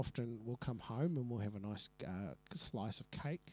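put-on soft, hesitant mummy's boy; Nigel. Telling of cake at home, soft hesitant oddball voice. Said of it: "Oh, he had a voice".

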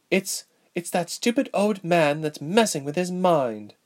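put-on high-pitched, frustrated young royal; young princess, exasperated. A narrator's reading. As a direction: vent like a powerless little sister. Narrator voices exasperated princess.

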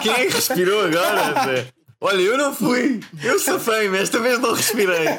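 high giggly voice through laughter; after laughing hard. Real, not acted. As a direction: squeak the words out while still laughing. Laughing at the breathing joke.

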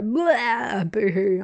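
hammed-up comic bawling; mock crying. Viewer recalling the scene that broke their heart, mock crying. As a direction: burst into exaggerated fake boo-hooing. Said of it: "it really made me cry".

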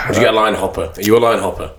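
usual speaking voice

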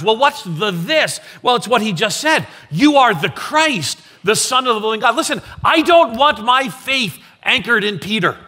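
impassioned sermon peak; preaching at full force. Acted. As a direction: proclaim it boldly. Pastor peaks on the confession.

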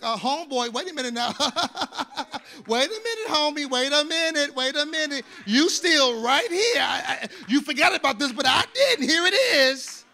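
a teasing inner voice catching him out, laughing through it; Holy Spirit speaking. Retold or imitated. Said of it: "Holy Spirit pricks my heart and be like".

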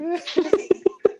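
real laughing fit; high burst of laughter. Real, not acted. Laughing at own story.

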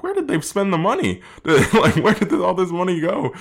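high-pitched mock disbelief; incredulous. Reviewer griping about where the film's budget went, incredulous.

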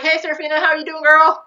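sing-song friendly greeting. Retold or imitated. A kind lady greets Serafina, lightly mimicked.

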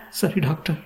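high, small obedient reply; meek mother. A narrator's reading. Mother obeying the doctor, meek high reply. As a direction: agree meekly in a high voice.

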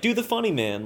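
lightly voiced pleading kid; imitated child. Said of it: "they're just like".

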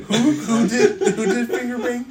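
words squeezed out through laughter; giggling while asking; laughing. Real, not acted. Giggling disbelief at a joke.

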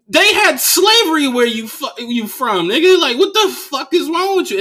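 high, loud outraged yell; retold onstage rant, loud and high; heated rant. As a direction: blow up at the heckler. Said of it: "I go on stage and I said".

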